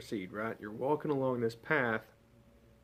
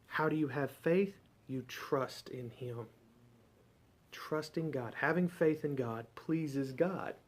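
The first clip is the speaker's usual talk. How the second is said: normal talking voice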